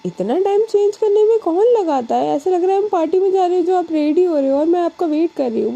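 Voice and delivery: high, quick mock-impatient call; impatient teasing complaint. Alisha calling through the door to Anu, impatient. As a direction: tease her friend for taking forever.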